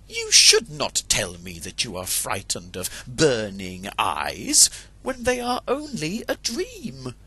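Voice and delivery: a hen wife who wants a brave cockerel; high scolding hen; scornful hen wife. Acted. Bertelotte chides Chanticleer.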